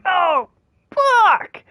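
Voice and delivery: very high, drawn-out yelp; pained exclamation. Retold or imitated. Player reliving the line that hit him in the gut, high pained yelp. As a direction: wail it like a punch to the gut.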